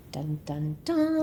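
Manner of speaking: dramatic dun dun dun. Pretend. Dramatic sting after ominous line.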